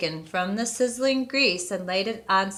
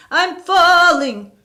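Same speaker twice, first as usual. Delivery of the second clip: high, loud, slow booming call; booming ghost; 10 semitones higher, 10 dB louder, slower than usual. A narrator's reading. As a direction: boom it down the chimney. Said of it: "the voice boomed".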